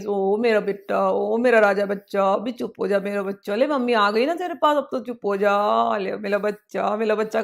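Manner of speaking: put-on a mother calming her child on her lap; loving mother. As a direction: hush a crying child gently. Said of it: "प्यार से उसको सहलाएगी".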